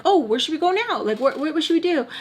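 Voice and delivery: high, eager kiddish voice; mock childlike eagerness; like excited kids. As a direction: mimic adults acting like little kids. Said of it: "like, kids".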